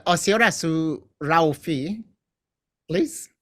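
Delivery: usual speaking voice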